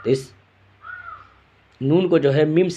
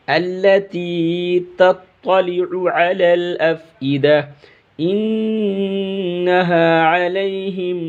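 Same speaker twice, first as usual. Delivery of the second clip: slow, melodic, higher chanted tilawat; Quran recitation; 3 semitones higher, much slower than usual. Acted. Teacher closes the lesson reciting the full surah, melodic chant. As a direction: recite the whole surah in a flowing sung voice.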